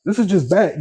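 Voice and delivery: put-on high, scandalized old man; disapproving elder, clutching pearls; old guy voice. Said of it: "I sounded like the old guy".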